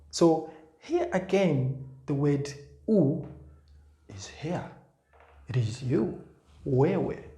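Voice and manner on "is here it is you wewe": ordinary delivery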